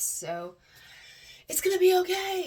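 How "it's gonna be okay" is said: high, loud, bright burst of reassurance; excited cheer. Real, not acted. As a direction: throw it out with sudden joy. Burst of optimism.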